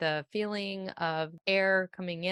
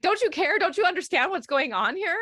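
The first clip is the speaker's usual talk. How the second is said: imitating the husband, much higher and louder, frantic; panicked husband; 11 semitones higher, 6 dB louder, faster than usual. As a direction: play the panicking husband, voice shooting up. Husband panicking over leaking fridge.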